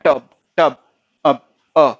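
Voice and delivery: टब cut short and loud; short light अ. Shown as a demonstration. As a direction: clip the अ in टब and stop. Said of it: "पूरा अ नहीं पढ़ना है, ... छोटा सा पढ़ के".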